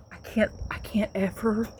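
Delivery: breathy whispered confession. Real, not acted. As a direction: drop to a whisper before the laugh. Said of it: "tell this story without laughing".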